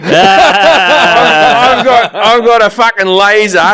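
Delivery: high-pitched, hammed-up Aussie villain; Australian Bond villain. Bond villain threatening from his lair, mock rant. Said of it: "there's never been a Bond villain".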